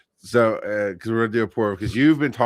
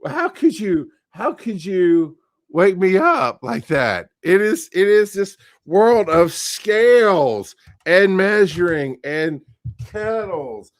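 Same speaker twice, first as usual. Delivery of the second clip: melodramatic lament; 8 semitones higher, 4 dB louder, slower than usual. Acted. Mock dramatic lament.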